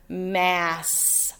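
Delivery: mass said very slowly; slow example. Shown as a demonstration. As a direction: linger on mass. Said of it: "two S's together".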